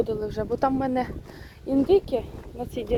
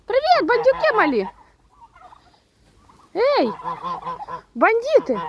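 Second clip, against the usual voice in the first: high, loud fond call to the chicks; excited greeting; 12 semitones higher, 4 dB louder than usual. Real, not acted. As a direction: greet the little rascals with delight. (високо і радісно гукає до індичат)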